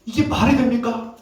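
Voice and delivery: 높은 음으로 따져 묻는 항변; mildly angry protest to God, pitched high; 하나님께 화냄. Retold or imitated. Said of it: "하나님께 막 화를 내는 거죠". (시인을 흉내 내며 높게 따지듯)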